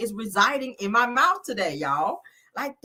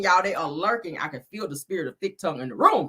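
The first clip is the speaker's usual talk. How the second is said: ordinary delivery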